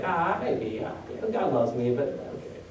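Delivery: soft, half-hearted brush-off, trailing to breath; mock a lukewarm believer who barely cares; quietly shrugging off God's love; dismissive shrug.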